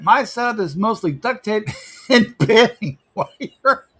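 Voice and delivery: high voice breaking into laughter; reading aloud through real laughter; laughing while reading. Real, not acted. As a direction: crack up halfway through reading it out. Cracks up reading chat message.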